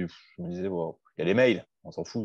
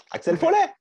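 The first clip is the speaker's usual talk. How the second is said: high squeaked name, much higher; squeaky Axel Foley. Acted. Friend squeaks the character's name in a much higher voice. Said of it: "C'est Eddie Murphy".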